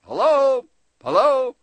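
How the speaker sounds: high, loud hello into an empty shop; calling out. Retold or imitated. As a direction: call toward the back room.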